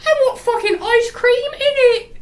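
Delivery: very high, loud mock girl voice; Elizabeth. Daughter wanting ice cream from the robot, high hammed-up squeal. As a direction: squeal it like a demanding child. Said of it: "And Elizabeth goes".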